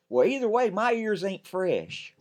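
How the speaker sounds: put-on cheeky boy doubling down; kid insisting. Ben insisting again.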